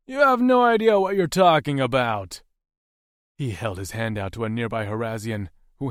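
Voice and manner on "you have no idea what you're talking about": loud, higher scornful retort; angry captor. Acted. Captor sneering at his prisoner, loud and angry. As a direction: cut him down with contempt.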